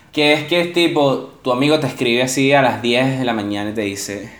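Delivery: ordinary delivery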